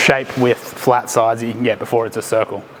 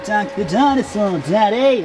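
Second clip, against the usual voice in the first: put-on high, slow little kid voice; child voice; 10 semitones higher, slower than usual. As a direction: squeak it like an excited toddler. Mimicking a child.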